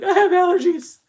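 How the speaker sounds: high-pitched, loud fake excuse; mock insistent excuse. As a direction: blurt out a desperate lie. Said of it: "I was like".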